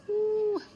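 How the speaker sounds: long, high-pitched "whoo". Real, not acted. Telling viewers about going through those pains, high whoo. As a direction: let out a drawn, high whoo. Exclaiming over remembered pains.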